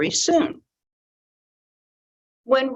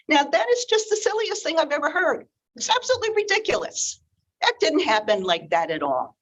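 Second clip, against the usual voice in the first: higher and faster, mock outrage at the silly version; scoffing disbelief; 7 semitones higher, faster than usual. Real, not acted. Storyteller scoffing at the old tale, exasperated. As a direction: rattle it off like it's absurd.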